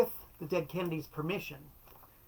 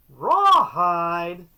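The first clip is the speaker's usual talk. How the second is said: a dry, puzzled echo, louder; deadpan questioning; 2 semitones higher, 12 dB louder than usual. Retold or imitated. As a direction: repeat it back as if it's a strange request.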